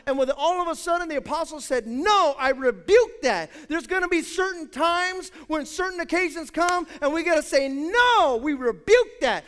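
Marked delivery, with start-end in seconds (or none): 1.9-3.5 loud, slow, firm rebuke; stern rebuke. Retold or imitated. Apostle rebuking Simon's bribe, loud and slow. 7.7-9.4 loud, slow, firm refusal; stern rebuke. Acted. Church leaders rebuking wrongdoing, loud and slow.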